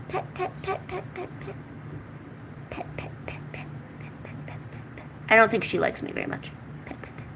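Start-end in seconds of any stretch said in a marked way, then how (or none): 0.1-5.1 tiny, very soft repeated whisper; whispered pet patter. Real, not acted. Murmuring while petting the animal. 6.9-7.3 tiny, very soft repeated whisper; whispered pet patter. Real, not acted. Murmuring while petting the animal.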